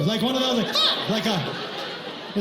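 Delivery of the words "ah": sudden high, mock yelp of pain. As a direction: yelp as if stung. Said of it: "hurts a little bit on the way out".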